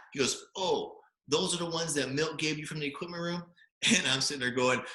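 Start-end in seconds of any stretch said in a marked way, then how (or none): 0.6-3.4 high, imitated coach's challenge; mock confrontation. Coach questioning freshman's socks, mock challenge. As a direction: put on a coach calling out a freshman.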